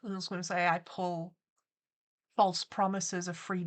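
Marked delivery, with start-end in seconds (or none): none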